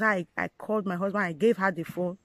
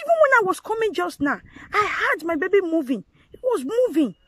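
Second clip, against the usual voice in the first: desperate insistence; 9 semitones higher, 4 dB louder than usual. Retold or imitated. Insisting to doctor baby moved.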